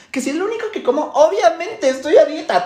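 high, emphatic self-defence; indignant justification. Acted. Dieter answering a classmate about her lunch, indignant justification. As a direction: answer as if it were obvious.

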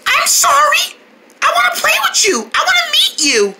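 high, eager pleading; pleading call. Acted. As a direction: beg the little bird to stay. Pleads with fleeing bird.

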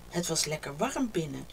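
ordinary delivery, nothing marked

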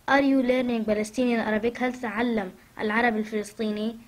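ordinary talk

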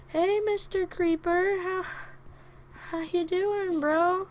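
high, slow, drawn-out hello; high wary greeting. Pretend. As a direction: coax a dangerous creature in a high voice. Nervously greeting a creeper.

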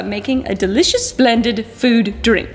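put-on slow posh British accent. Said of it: "I'm turning British now".

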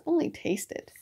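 high, soft, falling protest; small wounded plea; offended. A narrator's reading. Pooh pleads to taste honey, softly offended. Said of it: "in an offended tone of voice".

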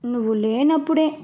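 usual speaking voice

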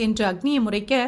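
ordinary delivery, nothing marked

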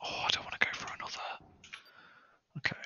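fast scared whisper. Real, not acted. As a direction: breathe it out quickly, afraid. Scared player whispers in dark game.

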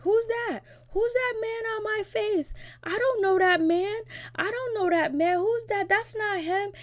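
high-pitched imitation of a tearful wail; mock crying. Tattoo client rejects the portrait of her son, mock crying. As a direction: wail it high, mimicking a distraught mother. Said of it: "And she's crying".

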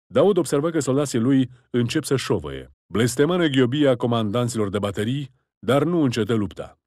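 plain reading voice

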